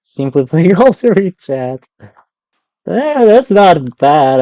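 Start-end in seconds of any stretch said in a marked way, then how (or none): none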